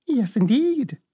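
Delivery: put-on high little old woman's voice, matter-of-fact; kindly old witch. Little old woman shows Dorothy the witch's feet, cheerfully high.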